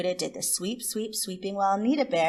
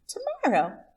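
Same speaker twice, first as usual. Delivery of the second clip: much higher, bright one-word answer; possum voice; 13 semitones higher than usual. Acted. Miss Possum answering Rabbit, high-pitched. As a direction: chirp it up high.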